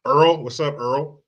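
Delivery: ordinary delivery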